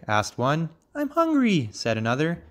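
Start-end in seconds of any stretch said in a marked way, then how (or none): 0.9-1.7 very high little frog voice; complaining frog child. A narrator's reading. Young frog crossing the field, high complaint.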